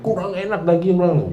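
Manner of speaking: lightly voiced grumbler, picky and dissatisfied; grumbling complaint. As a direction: imitate the people griping over their food. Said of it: "mereka ngoceh terus".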